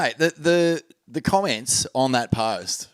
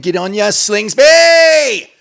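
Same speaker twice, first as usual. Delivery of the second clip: loud, high, drawn-out whoop; mock cheering; 10 semitones higher, 13 dB louder, much slower than usual. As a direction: holler praise to a mate. Cheering on the sailor.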